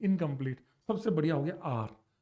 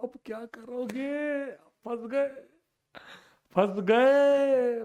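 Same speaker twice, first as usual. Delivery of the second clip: drawn-out, higher, teasing singsong; playful "gotcha" drawl, rising in volume; mock taunt; 5 semitones higher, 4 dB louder, much slower than usual. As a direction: stretch it out to tease the stumped class. Teasing class about a trap.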